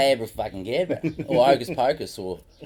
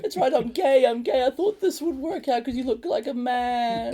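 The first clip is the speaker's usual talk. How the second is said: much higher put-on voice; high character voice; 12 semitones higher than usual. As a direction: squeak it out as a character. Acted character line from show.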